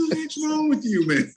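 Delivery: high, alarmed "what's wrong"; startled question. Retold or imitated. Will turning around to Dexter, startled high question. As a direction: react in surprise, voice jumping up.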